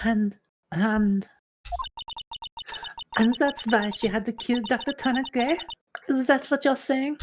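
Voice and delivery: high-pitched attempt at German; hesitant, high German-accented character voice. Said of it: "She's from Germany".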